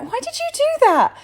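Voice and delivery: very high, loud disbelieving cry; loud high-pitched outraged question; exasperated why. Retold or imitated. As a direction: squeal it in disbelief at mum. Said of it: "later I'm like".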